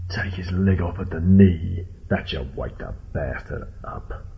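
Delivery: deliberate, sinister command; menacing possum boss. Acted. Possum boss orders cruel punishment.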